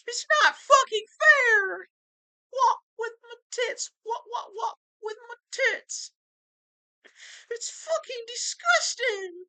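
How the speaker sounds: shrill high sobbing rant, far from his own voice; high wailing complaint; whiny crying voice. Acted. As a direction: bawl it out like a wailing man-child.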